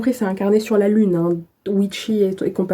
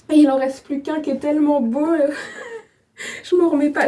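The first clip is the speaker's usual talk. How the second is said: high, giddy squeal with a laugh breaking through; can't contain the excitement over the gift; overjoyed; 6 semitones higher than usual. Real, not acted. Thrilled vlogger showing her last notebook to viewers, squealing high with delight.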